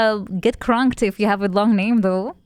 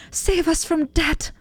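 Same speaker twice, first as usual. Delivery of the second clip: mock princess plea; 5 semitones higher, slower than usual. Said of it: "is she British nowadays".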